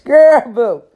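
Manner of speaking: put-on high, loud girl boo; sassy dismissal. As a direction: brush it off with sass. Mocking dismissal.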